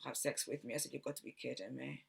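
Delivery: ordinary talk, nothing marked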